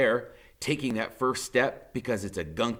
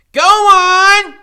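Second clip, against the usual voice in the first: very high, very loud shout from above; 19 dB louder than usual. A narrator's reading. Freak shouting down from above to run, full shout. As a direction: holler it down to him.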